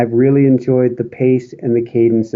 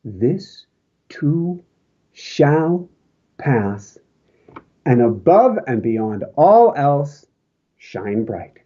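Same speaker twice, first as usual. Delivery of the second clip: slow send-off blessing; 3 semitones higher, much slower than usual. Acted. Closing blessing to the audience.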